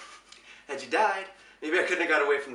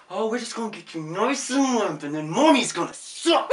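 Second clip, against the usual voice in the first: mock sing-song voice; 7 semitones higher, 9 dB louder than usual.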